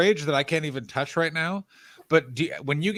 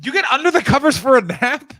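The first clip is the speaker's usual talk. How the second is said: excited; 8 semitones higher, 6 dB louder than usual. Real, not acted. Excited teasing about napping.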